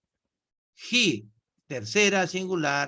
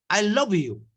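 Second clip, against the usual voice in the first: a touch louder, as if calling to a crowd; called out to a crowd; 4 semitones higher, 4 dB louder than usual. Shown as a demonstration. Teacher shows a singer calling to the crowd, slightly louder. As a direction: announce it to the whole concert hall. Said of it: "Y a una sola persona".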